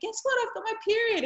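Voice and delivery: high, eager announcement; can't hold the news in; excited girl. Retold or imitated. Daughter telling her older brother, excited and high.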